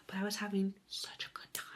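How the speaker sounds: whispered aside; soft whispered admission. Real, not acted. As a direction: hush it like the house is asleep. Whispered aside while reading.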